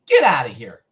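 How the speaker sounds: loud, exasperated dismissal; angry, holding back screaming. Retold or imitated. Driver on the phone to ice cream guy, angry dismissal. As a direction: send him away forcefully, just short of a scream. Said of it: "I'm trying to not scream".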